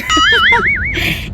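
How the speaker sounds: high-pitched giggle; laugh. Real, not acted. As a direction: laugh high at your own joke. Laughs at own "Char!" joke. (mataas na hagikhik)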